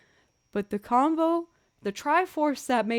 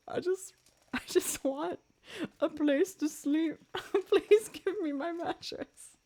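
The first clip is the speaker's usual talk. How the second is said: higher, laughing half-sob begging; mock tearful plea; 8 semitones higher than usual. Begging the phone agent, mock tearful. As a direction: plead for the mattress half laughing. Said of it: "I just ended up crying".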